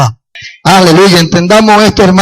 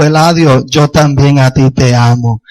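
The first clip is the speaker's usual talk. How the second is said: low, gentle voice of God; tender divine reply; 4 semitones lower than usual. Retold or imitated. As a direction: lower the voice into calm reassurance. Voices God's merciful, loving answer.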